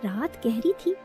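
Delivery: plain reading voice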